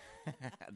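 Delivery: short high laugh. Real, not acted. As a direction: burst out laughing. Laughs at own joke.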